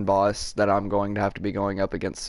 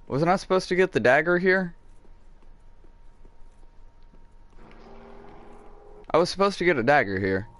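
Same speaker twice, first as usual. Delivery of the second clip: indignant complaint; 6 semitones higher, faster than usual. Real, not acted. Expected item is missing.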